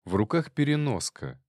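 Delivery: ordinary delivery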